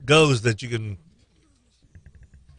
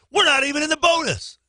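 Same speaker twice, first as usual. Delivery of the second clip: retold yell; 13 semitones higher than usual. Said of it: "Calipari yelling at the refs".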